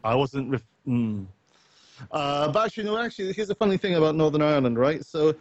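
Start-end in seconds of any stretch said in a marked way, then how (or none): none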